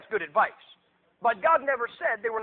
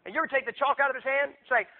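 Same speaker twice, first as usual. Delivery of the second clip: normal talking voice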